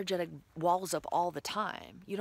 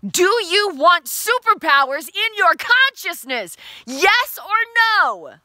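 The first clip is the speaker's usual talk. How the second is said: much higher, much louder and slow; rallying shout to the viewers; shouted with conviction; 11 semitones higher, 14 dB louder, much slower than usual. Shown as a demonstration. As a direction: yell it like you mean it. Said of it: "Say it like you mean it".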